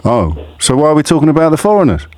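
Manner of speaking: usual speaking voice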